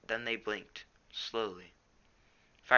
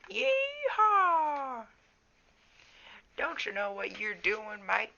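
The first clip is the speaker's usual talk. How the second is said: hammed-up southern accent, very high yeehaw; full southern accent; 5 dB louder, much slower than usual. Shown as a demonstration. Reader showing Graypaw's southern accent unrestrained, hammed up. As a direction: whoop it in a big southern accent. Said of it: "I've toned down Graypaw's, southern accent".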